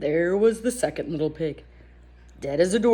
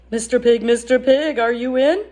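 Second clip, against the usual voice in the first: louder, lifted call at the door; 4 semitones higher, 4 dB louder than usual. Acted. Wolf calls to the pig in the stick house, raised call. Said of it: "I called".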